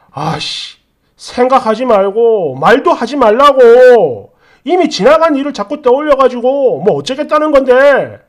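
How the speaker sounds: fed up, stretch out the demand; acted angry outburst; exasperated husband. Acted husband losing patience.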